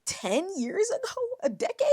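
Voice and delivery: high, loud disbelief; incredulous exclamation. Real, not acted. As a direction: react as if that number is outrageous. Reading, reacts in disbelief.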